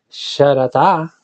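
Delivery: "sharatá" drawn out slowly with stress on the last A; stress on final A. Shown as a demonstration. Teacher shows listeners the Hebrew word "sharatá", stressing final A. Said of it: "con acento en la A".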